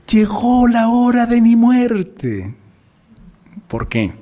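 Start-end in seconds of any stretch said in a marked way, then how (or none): 0.1-2.5 joyful announcement. Acted. Imagined sinless person greeting death.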